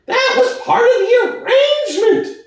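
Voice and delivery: high, quick, eager pig voice; Squealer crying out. Acted. Squealer answering Boxer, high and eager. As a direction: cry it out high and sly. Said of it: "cried Squealer".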